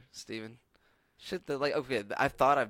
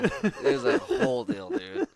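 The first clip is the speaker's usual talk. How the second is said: laughing, higher and louder; 9 semitones higher, 8 dB louder than usual. Real, not acted. Comedian laughing about the awkward Airbnb, giggling. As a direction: let the laugh spill through the words.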